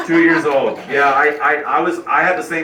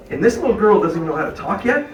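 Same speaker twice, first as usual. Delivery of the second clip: ordinary delivery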